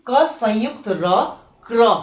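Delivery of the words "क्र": क्र said as one blended cluster kra; pronouncing kra. Shown as a demonstration. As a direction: blend क्र tightly, no vowel between. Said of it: "इसका pronunciation होगा क्र".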